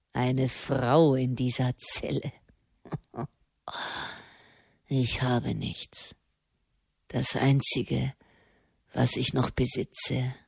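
put-on despairing young prisoner in a low voice; bitter prisoner, scornful. A narrator's reading.

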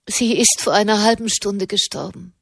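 ordinary delivery

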